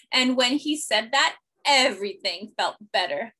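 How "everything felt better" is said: plain reading voice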